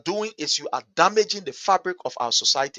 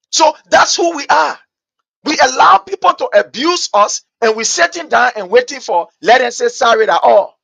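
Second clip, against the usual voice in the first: shouting; 7 semitones higher, 7 dB louder than usual. Real, not acted. Radio host shouting at Liberians who just wait for sorry, angrily. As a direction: hammer each word, furious.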